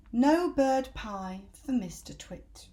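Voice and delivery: slow, deliberate title reading; chapter title. A narrator's reading. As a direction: announce the heading slowly. Chapter title read slowly.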